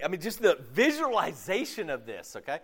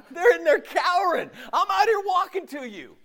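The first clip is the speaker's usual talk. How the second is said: much higher, laughing indignant complaint; mock indignant. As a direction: protest like a slighted disciple, half laughing. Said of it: "you know what I do? I go".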